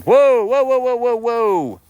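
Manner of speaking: high, loud startled whoa. Real, not acted. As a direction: stop the charging goat. Goat charging, calling whoa.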